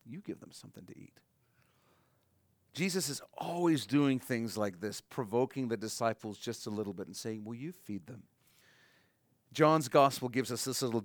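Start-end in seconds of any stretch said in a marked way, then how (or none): 0.1-1.2 a near-whispered, gentle repeat; hushed repeat. Acted. Jesus quietly telling the disciples to feed the crowd, hushed. 7.4-8.2 soft provoking question. Acted.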